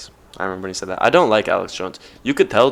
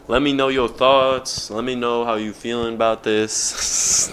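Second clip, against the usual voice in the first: drawn-out sign-off; slower than usual. Real, not acted. Vlogger signing off to viewers, asking for their thoughts, drawn out. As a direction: draw out each phrase in an exaggerated outro. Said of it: "I don't know why I be talking like this".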